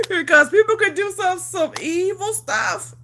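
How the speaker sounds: high pitched laugh turning to tears; laughing through hurt, voice breaking up; laugh-crying. Real, not acted. Telling viewers about her cheating ex, laughing through tears.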